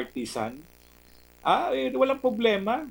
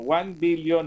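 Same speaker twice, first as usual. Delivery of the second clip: ordinary talk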